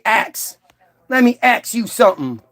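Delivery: "Ax" said with the k before the s, for "ask". Retold or imitated. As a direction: say "ax" in place of "ask".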